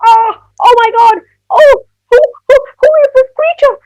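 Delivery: high, loud panicked squeal; terrified cry. Acted. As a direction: cry out in breathless alarm at a monster. Child sees a terrifying shadow.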